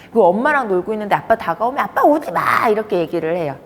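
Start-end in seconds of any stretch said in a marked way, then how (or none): 1.8-2.7 put-on high-pitched child's refusal; 아빠를 막는 아이. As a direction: tell dad off like a small kid. 다가오는 아빠를 막는 아이. (아이처럼 높게 거부하며)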